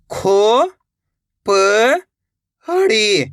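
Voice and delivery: "खो ... प ... हड़ी", drawn out with long gaps; letter by letter. Shown as a demonstration. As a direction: sound out "खो प हड़ी" separately. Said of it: "एक एक अक्षर का उच्चारण". ("खो ... प ... हड़ी" अलग-अलग, धीरे)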